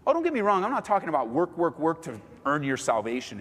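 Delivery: usual speaking voice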